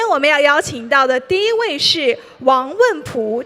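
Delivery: normal talking voice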